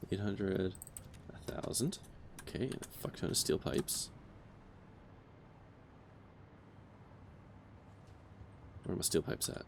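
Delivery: whispered counting to himself; muttering under breath. Real, not acted. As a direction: mutter it half-voiced while searching. Counting resources under his breath.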